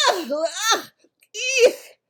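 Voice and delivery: loud ughs and a high eek; disgusted retching. A narrator's reading. Bloodbottler spits the vile vegetable, loud disgusted noises. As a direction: gag on the foul taste.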